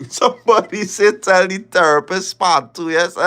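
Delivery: reading a comment and cracking up; laughing. Real, not acted. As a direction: laugh it out, amused.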